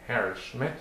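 plain reading voice

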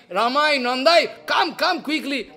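high, loud eager call; excited calling. Retold or imitated. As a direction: rush to call the friends. Jagadananda suddenly delighted, calling friends.

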